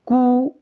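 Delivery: कु sounded out alone, pitched high; teacher demonstrating the u sound in कु; pronunciation demo. Shown as a demonstration. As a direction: lift कु as a separate syllable. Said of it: "तो इसका उच्चारण".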